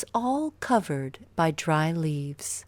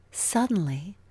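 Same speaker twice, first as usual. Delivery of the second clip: ordinary delivery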